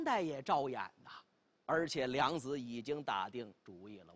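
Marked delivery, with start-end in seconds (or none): none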